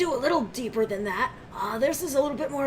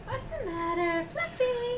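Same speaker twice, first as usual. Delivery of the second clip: cooing at a pet; 13 semitones higher, 6 dB quieter, slower than usual. Real, not acted. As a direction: coo gently at a pet. Cooing at the cat.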